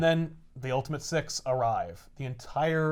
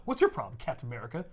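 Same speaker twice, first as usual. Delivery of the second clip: Fury, snapping back; 4 semitones lower, 5 dB quieter, faster than usual. Acted. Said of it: "And Fury's like".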